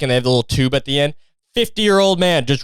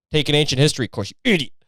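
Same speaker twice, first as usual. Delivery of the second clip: ordinary delivery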